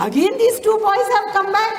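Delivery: high, loud mock exclamation; exasperated surprise. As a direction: exclaim in disbelief at being disturbed. Woken lady sees boys return.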